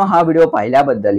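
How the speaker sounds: ordinary delivery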